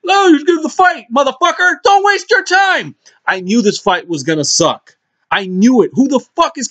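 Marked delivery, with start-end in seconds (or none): 0.1-2.9 mocking excited woman. Imitating women demanding the fight, high mocking voice. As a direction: ham up a pushy woman asking for the fight.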